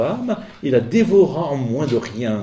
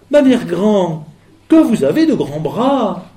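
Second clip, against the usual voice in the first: fillette étonnée; 7 semitones higher, 6 dB louder, slower than usual. Acted. La fillette s'étonne.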